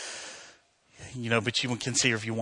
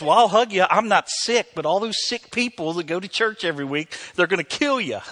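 raised-pitch, emphatic retold lecture; mock scolding; 6 semitones higher, 5 dB louder than usual. Doctor warning a hugger about sick churchgoers, mock scolding. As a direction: hammer it home with comic exasperation.

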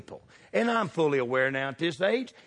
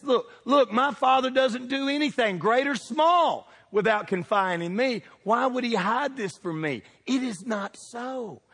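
Jonathan protesting; 7 semitones higher than usual. Acted. Jonathan insisting to David that his father hides nothing. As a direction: plead that it cannot be true. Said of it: "'Cause he says this".

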